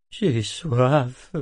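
raspy, hoarse little murmur. Acted. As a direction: croak it weakly. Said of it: "murmura-t-il d'une voix rauque".

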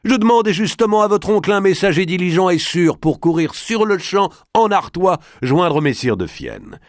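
high, loud, commanding noble; a forceful lord giving urgent orders; Robert d'Artois, bold lord. A narrator's reading.

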